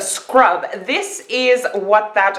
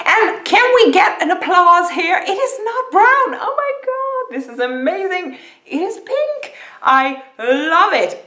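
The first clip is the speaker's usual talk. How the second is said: excited; 8 semitones higher than usual. Real, not acted. Delighted by unexpected pink lipstick.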